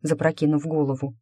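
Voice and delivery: ordinary delivery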